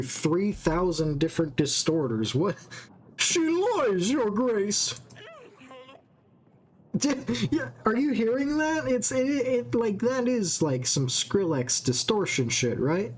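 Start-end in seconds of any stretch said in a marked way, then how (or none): 3.2-5.0 high, heavily distorted accusation; like it ran through a dozen distorters. Acted. Villager accuses woman before queen, distorted voice. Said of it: "did you hear that voice". 7.0-10.6 startled. Real, not acted. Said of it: "reacts to the voice, rising pitch".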